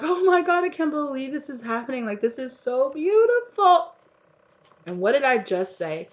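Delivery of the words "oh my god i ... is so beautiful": overjoyed gasp. Pretend. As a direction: play the listener overwhelmed with joy. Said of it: "You were just gonna be like".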